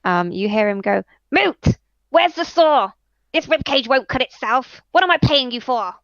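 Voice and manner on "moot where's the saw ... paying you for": high, loud, bossy yell at his helper; grumpy little kobold yelling across the room; angry kobold shout. Acted. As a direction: bark orders at the assistant. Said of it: "you see him suddenly start shouting".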